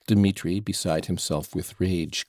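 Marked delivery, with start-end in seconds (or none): none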